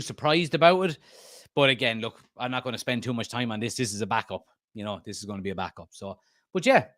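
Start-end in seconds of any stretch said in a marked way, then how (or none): none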